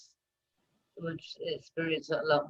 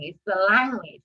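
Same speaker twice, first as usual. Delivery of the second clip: usual speaking voice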